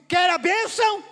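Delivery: high, booming question; shouted challenge. Acted. God asking the congregation about blessing, shouted challenge. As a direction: throw the question out at full volume.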